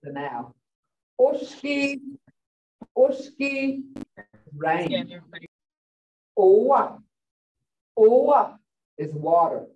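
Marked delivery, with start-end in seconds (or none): 1.2-3.8 higher oshki with a short, pure o; pure O sound. Shown as a demonstration. Teacher modelling the o in rain, pure-vowel oshki. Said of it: "Hear that? Oh". 6.4-8.6 loud owa leaning on the o; strong O sound. Shown as a demonstration. Teacher letting learners hear the o in water, strong O. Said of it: "Hear that? Oh".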